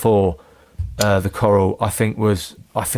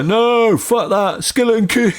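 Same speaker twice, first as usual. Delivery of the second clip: high, raised heckler imitation; mock heckling shout; 10 semitones higher than usual. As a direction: heckle back in a put-on voice. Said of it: "I go".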